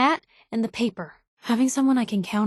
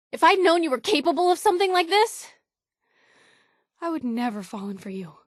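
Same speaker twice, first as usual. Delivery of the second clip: hurt anger; 6 semitones higher than usual. Acted. Betrayed, confronting partner.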